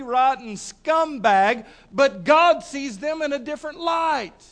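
loud, high, emphatic sermon delivery; impassioned preaching. Real, not acted. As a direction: proclaim it to the congregation, stretching key phrases. Preaches the point at full force.

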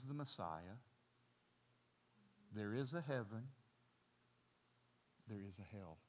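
low, hushed murmur; a demon hushing truths to a churchgoer; soft whisper in the ear. Acted. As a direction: breathe it into their ear.

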